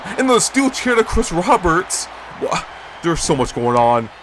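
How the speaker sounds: normal talking voice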